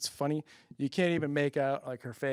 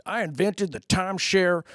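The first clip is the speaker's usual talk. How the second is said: Southern pitchman; 4 semitones higher than usual. Retold or imitated. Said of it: "he says".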